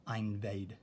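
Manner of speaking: "Einvade" said with a long I, like "eye-nvade"; wrong long I demo. Shown as a demonstration. Teacher showing students the wrong long-I reading. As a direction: stress the long "Ein" sound at the start. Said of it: "So that would actually say ein".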